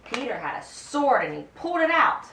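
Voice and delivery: plain reading voice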